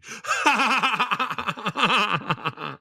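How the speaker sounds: big laugh at his own joke. Real, not acted.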